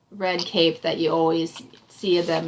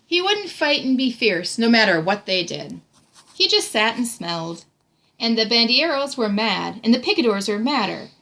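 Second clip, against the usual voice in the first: rising, lively picture-book reading; animated read-aloud, climbing to the last line; storybook narration; 4 semitones higher than usual. A narrator's reading. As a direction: build each line for the child. Reads story aloud to child.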